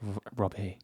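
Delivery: put-on low, hushed Scottish accent. Co-host echoing the listener's name, mock Scottish accent. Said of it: "Is that a, a Scottish accent?".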